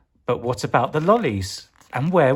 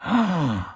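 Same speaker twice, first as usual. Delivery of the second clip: shocked gasp; 5 semitones higher than usual. Acted. Freezers switched off.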